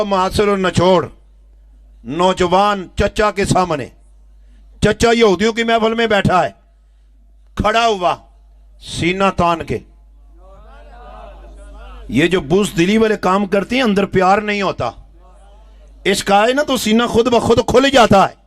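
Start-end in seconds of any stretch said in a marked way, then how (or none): none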